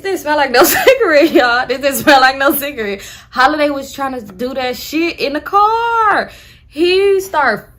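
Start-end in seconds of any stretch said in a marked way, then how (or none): none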